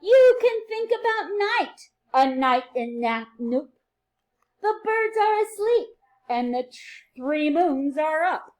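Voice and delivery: bright, sing-song read-aloud for little ones; reading to children. A narrator's reading. Teacher reading a rhyming book to her class, sing-song and lively.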